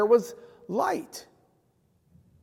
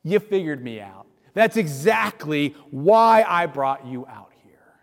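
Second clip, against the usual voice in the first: sarcasm; 5 semitones lower, 4 dB louder than usual. Retold or imitated. Moses mock-confessing to the Israelites, sarcastically. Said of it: "an inordinate amount of sarcasm".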